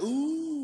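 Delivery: hammed-up audience gasp, high and loud; crowd ooh. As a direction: voice the whole room going ooh. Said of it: "The crowd's like".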